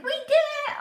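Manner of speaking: very high, loud mock cheer; all excited. Wife telling deployed husband she's pregnant, excited squeal. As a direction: squeal it like a celebration. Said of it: "All excited".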